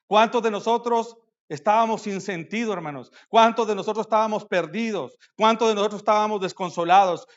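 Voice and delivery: loud, rising rhetorical questions hammered out; fiery preaching. Acted. Preacher rousing the congregation with repeated questions, loud fiery preaching. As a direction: preach at full force to the brothers.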